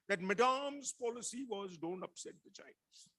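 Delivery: put-on quoting in a Bengali accent; Bengali-accented English, high. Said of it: "said in a very Bengali accent".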